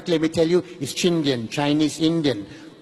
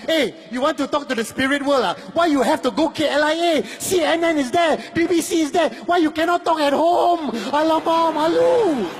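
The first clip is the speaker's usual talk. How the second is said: lightly voiced Malaysian auntie tutting, a bit higher; mock scold in Malaysian English; 6 semitones higher than usual. A Malaysian scolding people at the airport, light Malaysian English. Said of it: "every Malaysian said to himself".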